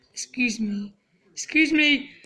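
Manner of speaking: usual speaking voice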